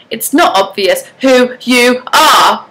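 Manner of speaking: slow, every word weighed separately; each word emphasized. Acted. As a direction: punch each word slowly like a riddle. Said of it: "I emphasized each word like a separate conundrum".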